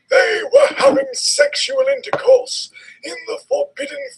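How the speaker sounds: hammed-up outraged old headmaster; Dumbledore voice. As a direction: squeal the accusation like a scandalised wizard. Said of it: "I love his voice for Dumbledore".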